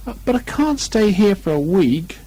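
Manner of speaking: a hint of a worried Pooh bear; lightly voiced Pooh. A narrator's reading. Stuck Pooh protesting to Christopher Robin, mildly dismayed.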